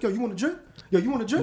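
playful, generous pal; eager drink offer. Retold or imitated. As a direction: call out the offer, pitch lifted. Acting out himself tipsy, offering drinks.

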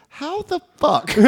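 high, hushed mock disbelief; shocked mom. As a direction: draw it out slowly as a stunned mother. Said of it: "my mom never curses".

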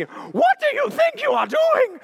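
high, loud, slow outraged yell; hammed-up furious shopper; angry customer yell. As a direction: scream at the clerk like an irate customer. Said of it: "she was not happy".

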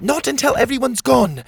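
high, insistent call; high-pitched determined reply; shouting back. A narrator's reading. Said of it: "Cogleen shouted back".

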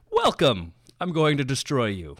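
polite English villain, welcoming then menacing; a gracious host who means harm; cordial villain speech. Retold or imitated. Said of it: "the cordial, very English-sounding villain speech".

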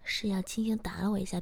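plain reading voice

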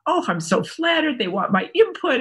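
high, gushing retold thought; mock flattered. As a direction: play up being pleasantly flattered. Acting out her naive flattered reaction.

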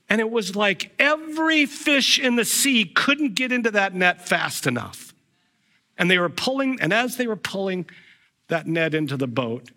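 ordinary talk

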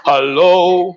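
drawn-out, projected hello; vocal coaching hello. Shown as a demonstration. Coach repeating hello for Linda, drawn out loud. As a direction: hold the hello out for a learner. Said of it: "give you some vocal coaching".

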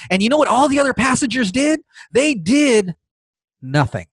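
ordinary delivery

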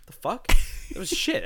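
high, loud disbelief; can't believe it; incredulous. Retold or imitated. Retold outrage.

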